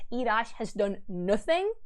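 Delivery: ordinary delivery, nothing marked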